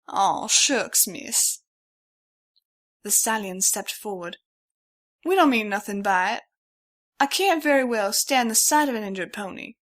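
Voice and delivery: the stallion apologizes for grabbing her; sheepish twang. A narrator's reading. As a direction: apologize like a bashful country stallion.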